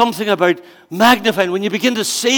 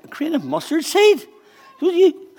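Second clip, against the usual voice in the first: incredulous; 8 semitones higher than usual. Retold or imitated. Listeners doubting Jesus.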